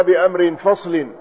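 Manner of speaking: "بأمر فصل" as bi-amrin faslin; tanween on amr. Shown as a demonstration. Teacher reading the hadith, "بأمر" said bi-amrin with tanween. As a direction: sound an n at the end of "بأمر". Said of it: "بتنوين أمر".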